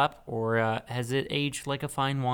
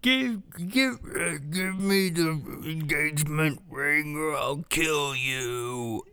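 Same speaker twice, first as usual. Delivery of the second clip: menacing bear; 3 dB quieter than usual. Acted. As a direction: threaten like a robbing bear. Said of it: "and the bear said".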